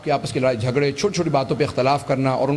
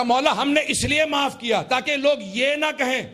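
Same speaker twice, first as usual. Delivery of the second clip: high, drawn-out shouted climax; full-force preaching; 13 semitones higher than usual. Real, not acted. Preacher telling the crowd why he told the story, full-force preaching. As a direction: cry it out to the crowd, pitch held high.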